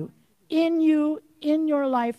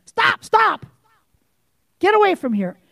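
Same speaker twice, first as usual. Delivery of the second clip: loud, higher furious command, climbing to a shout on "Stop"; angry rebuke; 8 semitones higher, 6 dB louder than usual. Real, not acted. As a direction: drive the devil off in real anger. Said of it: "Sorry, I am angry".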